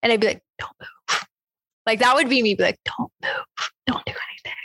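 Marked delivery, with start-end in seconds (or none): 0.6-1.2 soft unvoiced whisper. Frozen in fear of the figure, whispering. 2.8-4.6 soft unvoiced whisper. Frozen in fear of the figure, whispering.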